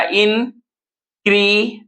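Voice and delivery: "इनक्रीडे" slowly, with gaps between syllables; broken into syllables. Shown as a demonstration. Teacher breaking the word up for students, slow separated "इनक्रीडे". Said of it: "लिखते हैं तोड़ के".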